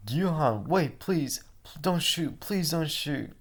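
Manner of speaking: high, pleading voice; begging hostage. Acted. Hostage pleading with Gyuhan, in a high frightened voice. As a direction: plead with him not to shoot.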